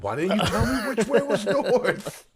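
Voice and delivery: high, drawn-out comic wail; hammed-up exasperated cry, slow and high; mock outburst. Retelling a lost character's outburst at chapter's end, mock exasperated. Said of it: "the outburst at the end".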